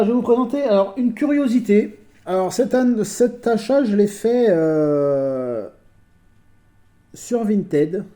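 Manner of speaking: ordinary talk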